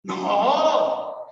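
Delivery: usual speaking voice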